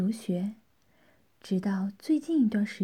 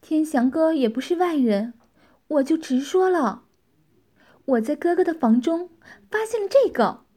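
higher, louder female character voice; straight to the point; 5 semitones higher, 5 dB louder than usual. A narrator's reading. Tianqi showing Tianxiang what she found, direct. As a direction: come right out with it, rising in pitch. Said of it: "开门见山地说道".

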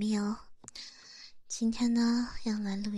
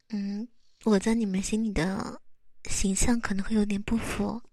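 ordinary talk

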